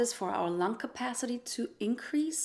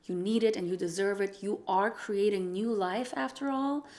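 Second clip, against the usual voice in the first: ordinary talk, nothing marked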